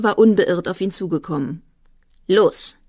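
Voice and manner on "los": short, raised challenge; mildly aggressive call. A narrator's reading. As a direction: goad someone into a fight. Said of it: "rief er".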